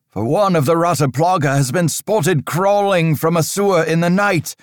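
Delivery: put-on stern old priest. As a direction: lecture the boy on dark times like an anxious cleric.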